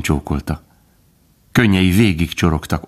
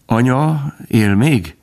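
high, slow, hesitant question; timid and quiet; 7 semitones higher, much slower than usual. A narrator's reading. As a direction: ask it shyly, drawing it out. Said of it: "kérdezte félénken és halkan".

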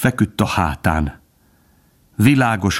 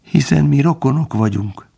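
higher-pitched, humble complaint; plaintive; 7 semitones higher than usual. A narrator's reading. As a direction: appeal to kinship, lamenting.

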